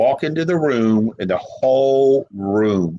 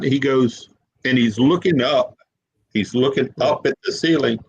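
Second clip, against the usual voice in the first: usual speaking voice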